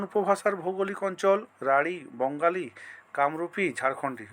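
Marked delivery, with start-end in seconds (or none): none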